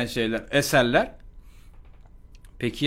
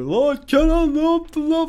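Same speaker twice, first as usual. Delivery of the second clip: over-the-top friend; 13 semitones higher, slower than usual. Retold or imitated. Friend Ahmet overreacting to Kenan, high and frantic. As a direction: ham up a panicky friend. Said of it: "inanılmaz aşırı tepkileri vardır".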